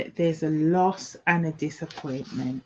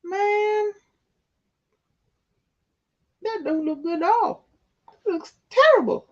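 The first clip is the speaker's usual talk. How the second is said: mock dismay; 10 semitones higher than usual. Dismay at bad tarot card.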